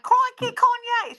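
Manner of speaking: high-pitched, hammed-up Australian voice; Australian accent. Showing how [name]'s Australian partner talks, hammed-up Australian accent. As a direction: squeal it in a mock Australian accent. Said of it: "Australian, so she's more like".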